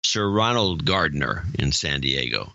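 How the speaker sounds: ordinary talk, nothing marked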